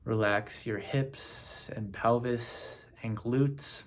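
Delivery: slow, measured meditation voice with long pauses; name each part unhurriedly, leaving space to relax; guided body scan. Acted. Meditation leader guiding listeners through the body, slow and spaced.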